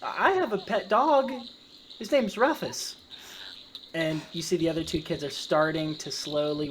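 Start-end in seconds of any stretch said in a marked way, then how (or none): 0.0-3.0 put-on high-pitched kid voice; child character. Kid chatting with the goblin about pets, childlike.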